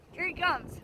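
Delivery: bracing as the bird dives in; alarmed. Real, not acted. Bird diving again.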